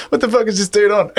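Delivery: high, laughing mock disbelief. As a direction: laugh through the question. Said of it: "I'm like".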